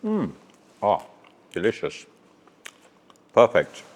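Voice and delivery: talking through a mouthful; words round a bite of food; mouth full. Real, not acted. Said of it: "I hate talking with my mouth full".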